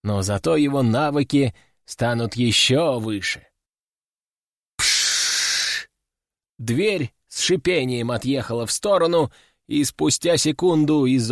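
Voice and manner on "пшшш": long unvoiced "pshhh"; imitated door hiss. A narrator's reading. Capsule door sliding aside in steam, long hiss. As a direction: hiss like a pneumatic door opening.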